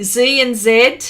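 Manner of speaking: first "Z" as ZEE, second "Z" as ZED. Shown as a demonstration. Host showing we say things differently, zee and zed. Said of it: "we all say things differently".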